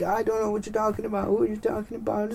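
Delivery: high, quick put-on voice; hammed-up confused nurse; flustered nurse. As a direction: protest quickly in a high-pitched voice.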